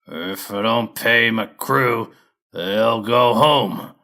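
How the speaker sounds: slow, loud growl of a hairy brute; growling lumber boss. Acted. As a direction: drag the words out like a tough crew boss. Fur explains his crew.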